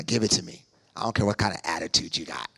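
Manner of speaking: a blunt low mock-God demand; gruff God. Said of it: "He just wants your money".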